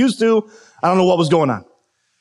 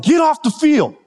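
high, raised shout; coach shouting; 12 semitones higher than usual. Retold or imitated. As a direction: bark the order like an angry coach.

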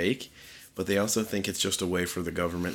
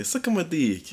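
high-pitched phony Italian; bad Italian accent; 10 semitones higher than usual. Insisting to his friend Italians say it, bad Italian accent. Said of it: "no, they would say".

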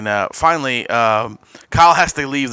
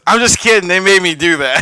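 mock apology; 6 semitones higher, 5 dB louder than usual. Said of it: "and he goes".

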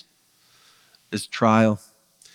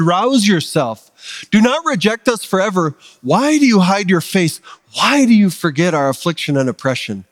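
raised pitch, urgent psalm plea; impassioned scripture reading; 5 semitones higher than usual. Acted. Preacher reading the psalm's plea for relief, impassioned. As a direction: read it as a cry to God for help.

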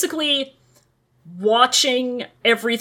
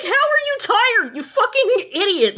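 higher, laughing insult; amused mock scolding; laughing retort; 8 semitones higher than usual. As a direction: scoff through giggles at the idiot. Retorting to villain while laughing.

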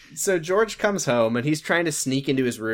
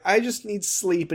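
higher, slow mock tearful voice; put-on weary near-crying; holding back tears; 6 semitones higher, slower than usual. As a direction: imitate someone fighting back tears. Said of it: "like, holding back tears".

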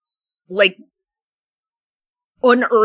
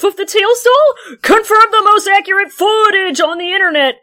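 mock hype; 9 semitones higher than usual. Reading video titles in mock hype.

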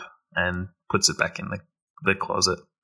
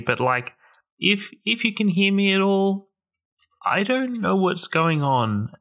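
higher, lost and pleading; Jacques pleading; 7 semitones higher than usual. Acted. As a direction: plead up into the dark, confused. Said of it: "he looks up and he says".

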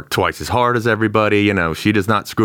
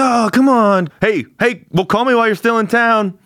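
high, louder call-out; calling after them; 12 semitones higher, 3 dB louder than usual. Acted. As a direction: shout after them as they leave. Trevor calls after departing agents.

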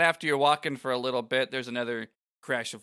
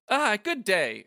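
Shalinda voice; 8 semitones higher, 4 dB louder, slower than usual. Acted. Voicing Shalinda's greeting.

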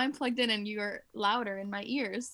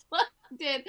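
laughing hard through the words; can barely get it out for laughing; 6 dB louder than usual. Real, not acted. Laughing hard at headphone mishap.

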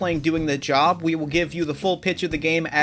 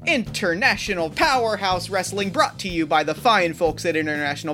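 put-on booming kids show opener; 4 semitones higher than usual. Wrestling show host opening.

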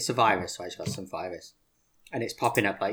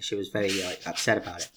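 ordinary talk

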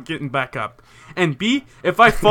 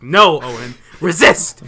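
mock inner shout; 7 dB louder, slower than usual. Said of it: "I was like".

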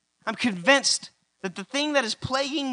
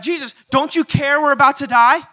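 louder, desperate accusing cry; panicked plea; 5 semitones higher, 7 dB louder than usual. Retold or imitated. Disciples crying to Jesus, louder and desperate. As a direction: demand an answer in fear. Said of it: "and they're like".

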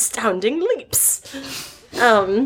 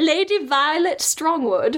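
grand lady voice; 6 semitones higher, slower than usual. Pretend. Reading out roleplay name.

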